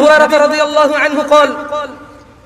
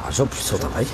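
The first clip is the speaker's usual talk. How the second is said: muttered aside; 10 dB quieter than usual. Real, not acted. Muttered aside about audience.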